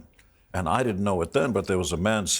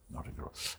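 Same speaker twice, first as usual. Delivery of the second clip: whispered aside; 17 dB quieter than usual. Real, not acted. Whispered aside about the language.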